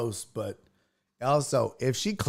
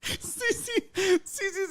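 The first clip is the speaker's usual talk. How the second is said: laughing through the name, much higher. Real, not acted. Host laughing while reading a viewer's comment, high and giggly.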